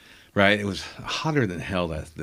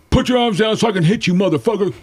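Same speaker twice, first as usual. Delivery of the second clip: fast, high, loud mock rage; put-on raging shout, rushed; imitated angry brother; 10 semitones higher, 4 dB louder, faster than usual. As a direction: yell it like a furious kid brawler. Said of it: "And he goes".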